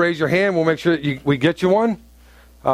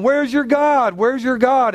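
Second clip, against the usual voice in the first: higher, jeering voice of the scoffers; mocking taunt; 6 semitones higher, slower than usual. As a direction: throw the question at him like a jab. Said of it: "they're going".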